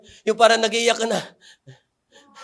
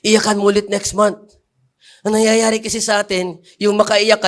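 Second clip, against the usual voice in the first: ordinary talk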